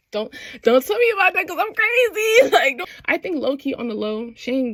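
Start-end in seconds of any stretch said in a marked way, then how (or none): 0.1-2.9 high-pitched, hammed-up crazy protest; mock jealous outburst. Shane hearing about Svetlana, mock crazy. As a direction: wail it as a comic tantrum.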